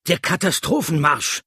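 loud, high-pitched shout back; called over shoulder. A narrator's reading. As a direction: call it back to someone behind you. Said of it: "rief er mir über die Schulter zu".

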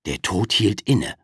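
plain reading voice

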